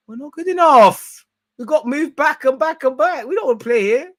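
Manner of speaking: high, loud exasperated complaint; protesting outburst. Acted. Streamer speaking up for unwilling fullbacks, loudly protesting. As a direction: shout it as a hammed-up grievance.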